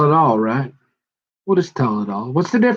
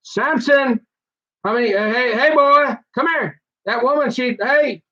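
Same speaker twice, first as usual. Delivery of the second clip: much higher frantic hollering; calling out; 8 semitones higher than usual. Retold or imitated. Friends call Samson over, much higher and frantic. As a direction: yell for him like worried friends. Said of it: "everybody's like".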